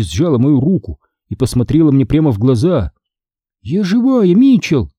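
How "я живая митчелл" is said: ordinary delivery, nothing marked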